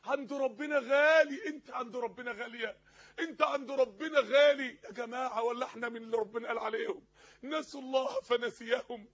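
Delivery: loud, drawn-out exhortation, ringing on key words; impassioned preaching. Acted. Preacher pressing the congregation on their worth to God, impassioned. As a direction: preach it forcefully, stretching the long vowels.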